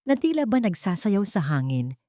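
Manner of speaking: ordinary delivery, nothing marked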